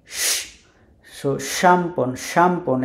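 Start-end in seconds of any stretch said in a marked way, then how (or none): 0.1-0.4 শ as a long vowelless sh; hushing sh. Shown as a demonstration. Teacher demonstrates Polish SZ, long hushing শ. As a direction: hold শ as a hiss of air. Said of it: "স্বর মতো উচ্চারণ করে".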